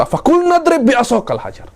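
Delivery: very high, emphatic order; commanding recitation. Shown as a demonstration. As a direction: ring it out as a command from God. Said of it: "Allah menyuruh kepada Nabi Musa".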